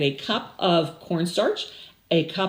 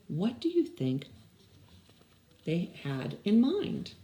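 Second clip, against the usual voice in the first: ordinary delivery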